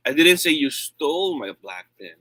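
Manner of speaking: emphatic "stole", the end dropping; stress on STOLE. Shown as a demonstration. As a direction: hit the word "stole" hard. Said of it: "I change the word stress".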